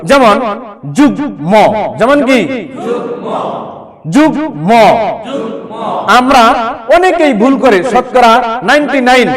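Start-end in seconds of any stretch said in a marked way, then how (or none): 1.0-4.7 যুগ্ম said with a clearly sounded গ and ম, jug-mo; clear m-phala pronunciation. Shown as a demonstration. Teacher to students, modelling যুগ্ম with a clearly sounded ম. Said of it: "উচ্চারণ সুস্পষ্ট হবে".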